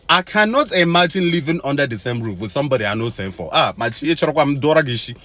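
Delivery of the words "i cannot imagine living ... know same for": normal talking voice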